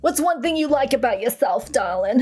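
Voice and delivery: put-on Jersey auntie voice; Aunt Hilda. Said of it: "Let's do Aunt Hilda for this one".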